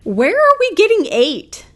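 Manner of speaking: high, loud disbelief; can't believe the number; incredulous. Real, not acted. Viewer reacting to income claim.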